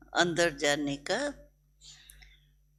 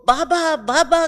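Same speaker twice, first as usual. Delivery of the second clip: high, loud devoted calling of Baba; an excited call rising high and loud; 9 semitones higher, 8 dB louder than usual. Retold or imitated. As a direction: call his name out eagerly from the door.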